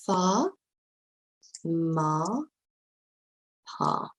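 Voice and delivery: "Fa, ma, pa" each on a long open AH; long AH sound. Shown as a demonstration. Teacher showing the R sound in three words, long AH syllables. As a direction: say "Fa", "ma", "pa" apart, drawing out the AH. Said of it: "the R sound".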